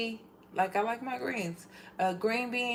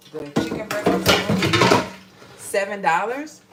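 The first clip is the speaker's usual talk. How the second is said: excited; 10 dB louder than usual. Real, not acted. Excited about cheap price.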